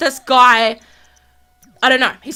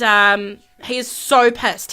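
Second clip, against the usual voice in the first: ordinary delivery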